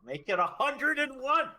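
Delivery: high, loud cheer; pumped up and celebrating; excited. Real, not acted. Cheering the follower count.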